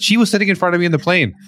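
laughing. Real, not acted. Laughing while telling her guest about the plane. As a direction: laugh the words out fast.

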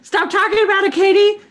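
high, loud, laughing plea to stop; mock-distraught protest. Cohost begging Katie to stop the tearjerker, mock-distraught. Acting out pleading with teller to stop.

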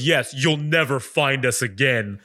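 slow, ominous mock villain; Darth Vader. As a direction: taunt like a villain over a megaphone. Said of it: "that's ominous".

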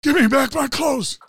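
high, loud shouted demand; shouted movie line. Acted. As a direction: yell it like an action hero. Terminator line acted as Mel Gibson.

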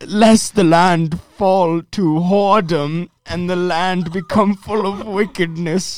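put-on high, loud, slow King James accent, like a pompous preacher. Reading Leviticus aloud to podcast friends, in a hammed-up King James accent. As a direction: boom out the verse in a mock King James accent. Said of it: "keep reading this verse in your, uh, King James accent".

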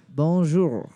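put-on slow, low French accent; mock French accent, drawn out. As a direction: ask for a conure like a Frenchman ordering a canoe. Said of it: "Like a very Frenchman".

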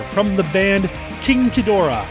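normal talking voice

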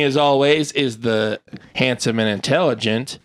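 normal talking voice

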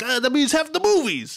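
high, hammy dad joke; mock Tony joke. Dad punning to his daughter, hammy and high. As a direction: sell the corny pun up high. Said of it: "He's like".

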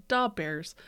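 put-on light Chicago SNL accent; a hint of the Da Bears guy. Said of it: "that S N L skit where it's like".

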